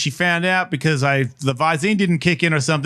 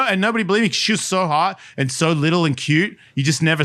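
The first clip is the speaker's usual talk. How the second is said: usual speaking voice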